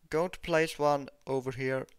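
usual speaking voice

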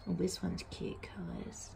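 soft slow whisper; hushed admiration. Real, not acted. Admiring gift softly.

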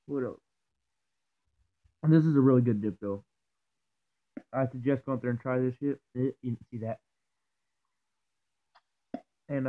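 ordinary talk, nothing marked

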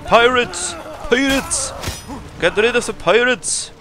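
high, loud play-alarm cries; excited battle cry; mock alarm. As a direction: call out an attack in mock panic. Calls out pirates during game fight.

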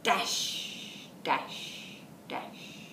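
fading whispered dash. Acted. Teacher reading Garden Stew to toddlers, fading whisper. Sound words in story.

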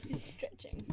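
hushed whisper. Real, not acted. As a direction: whisper it under your breath. Whispered aside.

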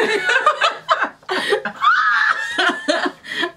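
shrieking laugh. Real, not acted. Big laugh.

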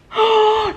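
a sharp, high gasp of horror; shocked high gasp. Real, not acted. Seeing her own face on camera, a sudden high gasp.

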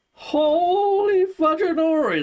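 high, loud, drawn-out awe; excited exclamation. Real, not acted. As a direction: gush over the gift slowly. Marvelling at a gift.

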